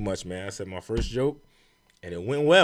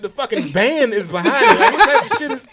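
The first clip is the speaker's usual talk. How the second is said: high, loud, laughing disbelief; incredulous outrage; 8 semitones higher, 6 dB louder than usual. Retold or imitated. Comic finding the band behind him booing, incredulous. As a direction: pitch it way up, baffled and offended.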